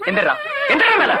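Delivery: high-pitched urgent order; urgent calling out. Acted. Man ordering someone to get up, urgent high-pitched call.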